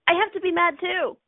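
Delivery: high-pitched put-on indignation; mock reactive insistence. Acting out catching another person's anger, mock indignant. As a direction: pitch it up, playing the knee-jerk reactor. Acts out automatic reactive thought.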